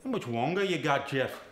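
a cockney geezer asking for money; cockney character voice. Acted. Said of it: "give him a sentence, [name]".

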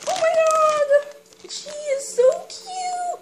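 very high, gushing squeal; excited squeal. Real, not acted. Collector squealing to viewers over a ladybug toy. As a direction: coo it out delighted.

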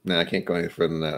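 ordinary delivery

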